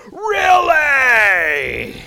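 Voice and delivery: one long, booming mock shout; hammed-up holler that slides down in pitch; really loud passenger. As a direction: impersonate a loudmouth, stretching the word out. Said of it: "And it's really loud".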